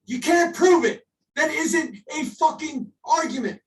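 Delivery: you can't out-argue this, shout it; angry shout. Real, not acted. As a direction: yell it at the flat earthers, fed up. Heated flat earth argument.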